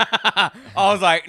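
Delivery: laughing through the words; giggling as you start the quote. Real, not acted. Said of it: "laughing while telling".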